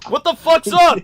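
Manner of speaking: loud, high mock outburst; retold yell. Friend retelling George's outburst over haggis, loud mock shout. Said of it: "It's like".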